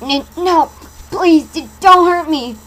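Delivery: high, loud stammered plea; stammering fear. Acted. Terrified girl pleads with Masky, high, loud and stammering. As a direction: beg him not to hurt you, stuttering. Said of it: "as I stammered".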